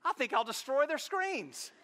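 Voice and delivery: cat scheming against the owners. Retold or imitated. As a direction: plot it like a sly cat. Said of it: "he's like".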